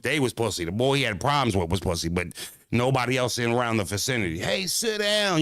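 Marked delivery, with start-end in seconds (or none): 4.4-5.4 slow, high-pitched mocking sit down; mock high call. Retelling the stadium crowd, slow high mocking call. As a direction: stretch it out high like a scold.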